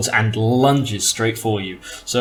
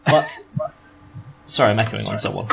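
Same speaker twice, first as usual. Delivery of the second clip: ordinary talk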